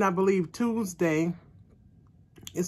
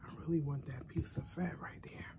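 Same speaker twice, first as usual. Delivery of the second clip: soft secret whisper; 4 semitones lower, 17 dB quieter than usual. Real, not acted. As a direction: whisper it like a guilty confession. Whispered confession, don't laugh at me.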